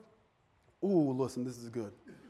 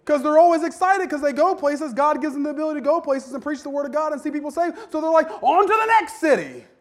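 high, loud excited preaching; preacher rising into excited praise; fired-up preaching; 7 semitones higher, 5 dB louder than usual. Acted. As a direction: lift it up with revival fire. Preaching at full force.

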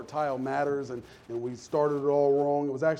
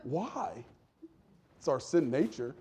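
ordinary delivery

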